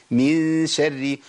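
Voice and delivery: شري with the doubled ra light, held slow; correct pronunciation. Shown as a demonstration. Teacher giving the right form of من شري, slow and light. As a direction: lengthen the doubled ra in من شري, kept thin. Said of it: "هي شري".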